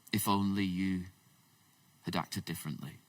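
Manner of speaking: soft, sighing and falling away; a tired sigh, then the regret trails off quietly. Retold or imitated. Worshipper sighing a regret to God, soft and fading. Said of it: "there are times where you're going".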